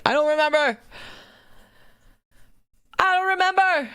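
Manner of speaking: high exasperated cry; frustrated outburst. Real, not acted. Streamer unsure of the video date, exasperated cry. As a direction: throw up your hands vocally.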